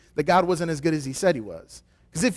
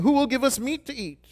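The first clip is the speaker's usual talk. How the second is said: whiny Israelites; 8 semitones higher than usual. Retold or imitated. Israelites whining quote.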